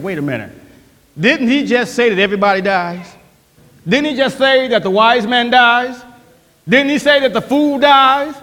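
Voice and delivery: ordinary talk, nothing marked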